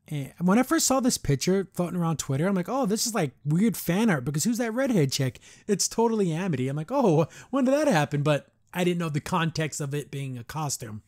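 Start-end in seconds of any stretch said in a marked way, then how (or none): 2.7-5.4 high, animated disbelief; retold puzzled surprise. Reacting to a picture on Twitter, retold surprise. 6.9-8.2 high, louder startled question; retold surprise. Seeing Amity in the picture, retold surprise.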